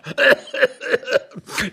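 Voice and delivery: high, cackling fit of giggles; helpless laughter. Acted. Couple laughing at the dusty intruder, helplessly.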